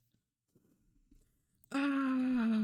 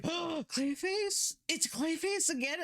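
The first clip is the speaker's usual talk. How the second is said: high, drawn-out mock astonishment; imagined surprised reaction; 9 semitones higher, slower than usual. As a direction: act out a crowd's high-pitched disbelief. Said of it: "they would have been like".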